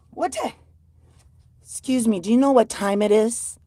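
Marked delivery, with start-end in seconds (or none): none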